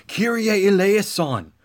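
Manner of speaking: slow, drawn-out plea for mercy; preacher showing the congregation how to cry out; prayerful cry. Shown as a demonstration. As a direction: pray it slowly, pleading. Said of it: "So cry out".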